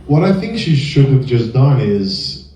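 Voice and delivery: ordinary talk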